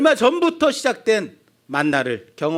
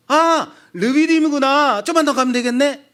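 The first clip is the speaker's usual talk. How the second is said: higher, louder exclamation of discovery; acted hopeful realization; 6 semitones higher, 4 dB louder than usual. Child hears from father that Rephidim is near, acted excitement. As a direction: play a thirsty child suddenly taking heart.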